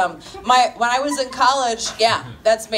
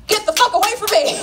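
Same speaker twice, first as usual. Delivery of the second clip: loud, high, fast yell at a creep; shouting him off; 10 semitones higher, 5 dB louder, faster than usual. Retold or imitated. Comedian yelling at the creep in the bar, loud and fast. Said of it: "I just looked at him and I went".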